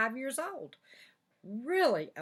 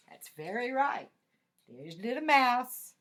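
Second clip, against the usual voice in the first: usual speaking voice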